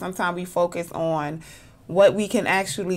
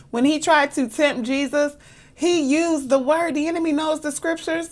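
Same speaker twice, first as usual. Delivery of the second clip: higher-pitched, impassioned preacher; fervent preaching; 7 semitones higher than usual. Acted. Preacher warning listeners that the enemy knows, fervent and high. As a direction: press each point home, pitched up.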